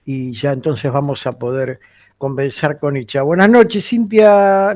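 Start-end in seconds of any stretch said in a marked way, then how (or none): 3.2-4.7 louder greeting, projecting to be heard; calling out loudly. Real, not acted. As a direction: call out to reach a bad phone line. Greeting caller on a weak line.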